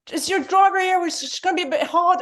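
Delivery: imitating the Tube driver's cockney; working-class London accent. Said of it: "we went from".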